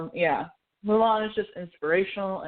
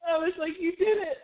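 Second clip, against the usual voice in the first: tearful cheering; 11 semitones higher than usual. Real, not acted. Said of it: "I'm gonna cry right now".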